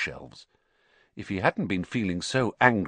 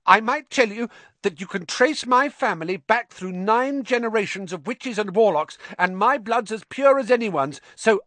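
high-pitched, louder self-defence; hasty, nervous; 13 semitones higher, 5 dB louder than usual. A narrator's reading. Ernie proving his pure blood to Harry, hastily nervous. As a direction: babble out credentials anxiously. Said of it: "he added hastily".

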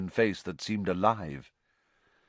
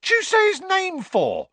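very high, loud panicked reproach; alarmed yelp; 7 dB louder than usual. A narrator's reading. Conductor scolds passenger for the forbidden name, yelping. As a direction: squeal the question in fright. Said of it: "yelped Stan".

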